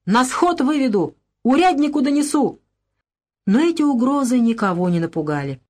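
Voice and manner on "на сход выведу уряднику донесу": high, straining threat; a beaten man yells threats at the women; strained shout. A narrator's reading. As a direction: push the threat with all his voice. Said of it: "до последней степени напрягая голос, закричал".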